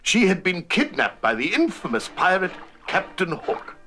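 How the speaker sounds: usual speaking voice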